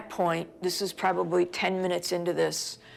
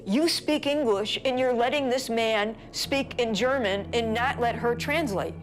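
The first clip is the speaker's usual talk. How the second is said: high, accusing retort; retold outrage, pitch raised throughout; indignant protest; 5 semitones higher than usual. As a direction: confront him with his double standard.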